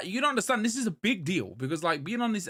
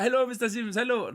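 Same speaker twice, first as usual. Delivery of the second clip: higher-pitched imitated Indian accent; 6 semitones higher than usual. Patient imitating his old dentist's greeting, in an Indian accent. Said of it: "I'm sorry about the accent".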